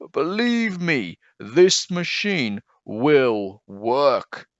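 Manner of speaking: slow, confident promise; reassuring. Acted. Leon promising the boy the machine will work, slow and reassuring. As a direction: lean in and slowly reassure.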